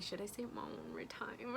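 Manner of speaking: scared whisper to herself; whispered thought. Retold or imitated. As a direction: whisper it as if afraid to be heard. Scared, unsure about mom on couch.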